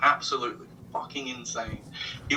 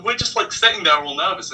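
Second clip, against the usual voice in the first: usual speaking voice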